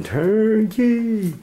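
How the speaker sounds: high, drawn-out holler; prisoners' yell. Retold or imitated. Urban explorer recalls prisoners calling the turnkey, yelling. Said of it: "hearing the prisoners yelling".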